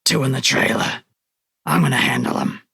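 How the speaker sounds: growling dog shifter reporting in; growled radio message. Acted. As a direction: growl it under control, tersely. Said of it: "growls into it".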